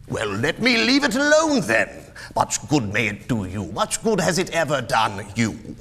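high, loud start settling into scorn; irritated Scrooge. Acted. Miser dismisses nephew's Christmas, irritated. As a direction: burst out, then drop to a sour jibe.

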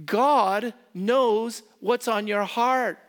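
each word pressed slowly and firmly; slow, weighty pulpit emphasis; slow emphatic stress. Acted. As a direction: lean hard on every word, slow and loud. Preacher stressing key line.

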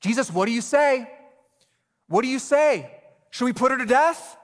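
high, loud demanding questions; badgering crowd. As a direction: press him hard like pecking chickens. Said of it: "They were like pecking chickens".

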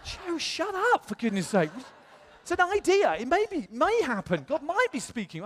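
high-pitched, flustered mock hushing; exasperated protest. Telling friend Joe to shut up, in high exasperated protest. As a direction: squeal a retold protest at a friend. Acting his alarm at exposed cover.

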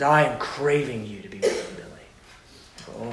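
hushed, low, ending in a whisper; intimate. Acted. God softly calling Billy by name, fading to a whisper. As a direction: breathe the name at the end.

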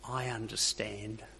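a quiet, sly voice of the tempter. Pretend. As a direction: coo it like a false friend. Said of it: "the little voice goes something like this".

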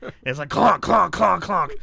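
host mimicking heels on tile; loud clonks. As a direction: stomp the clonks out.

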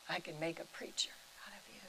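hushed, quick whisper of the sacred words. Real, not acted. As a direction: breathe it out in a whisper. Repeating God's words softly.

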